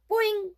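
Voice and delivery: loud, high cartoon boing; boing sound. Frying pan bonking the bear, loud boing. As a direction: ring out like a pan.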